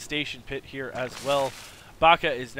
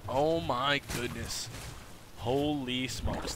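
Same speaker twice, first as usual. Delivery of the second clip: slow awed mutter; 6 dB quieter, slower than usual. Real, not acted.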